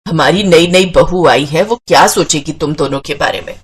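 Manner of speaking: put-on low, reproachful older relative; scolding mother-in-law. (सास की नीची आवाज़ में फटकार)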